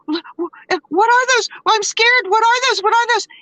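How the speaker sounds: high-pitched, stammering mock panic; Maureen freaking out. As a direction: imitate a friend's frightened, repeated questions. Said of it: "Mo freaked out".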